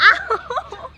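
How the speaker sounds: a laughing yelp of pain. Real, not acted. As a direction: yelp through the giggles. Hit while laughing.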